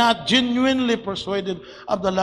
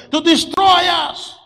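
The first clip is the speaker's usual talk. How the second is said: higher, loud outcry; mock shout of complaint; 7 semitones higher, 8 dB louder than usual. Preacher voicing the murmuring Israelites, loud mocking outcry. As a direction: cry it out like the grumbling people.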